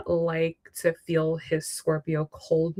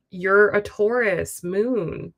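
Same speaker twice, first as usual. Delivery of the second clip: ordinary delivery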